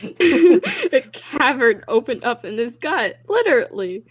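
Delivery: slow, laughing through the words; half-laughing narration. Real, not acted. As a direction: read it while cracking up. Laughing while reading.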